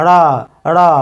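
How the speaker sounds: "রা" as a flapped ṛ, "ṛa"; retroflex flap. Shown as a demonstration. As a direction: curl the tongue back and flap it for "রা". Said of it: "সঠিক উচ্চারণ হবে".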